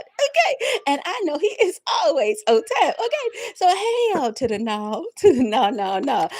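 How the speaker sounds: laughing high and loud through the words. Real, not acted. Preacher laughing to her viewers while testifying. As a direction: laugh it out, half crying.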